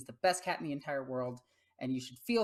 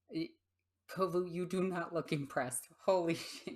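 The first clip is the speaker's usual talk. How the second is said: usual speaking voice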